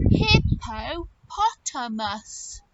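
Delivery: "hippopotamus" slow, in separate syllables; slow, emphatic naming. Shown as a demonstration. Teacher reveals the long proper name, slow emphatic "hippopotamus". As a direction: sound out every part of "hippopotamus".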